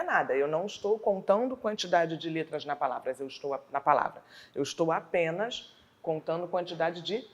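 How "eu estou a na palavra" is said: ordinary talk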